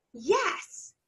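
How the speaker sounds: ordinary talk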